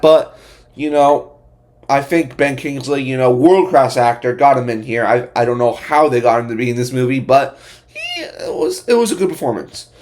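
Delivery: ordinary delivery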